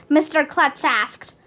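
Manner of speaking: usual speaking voice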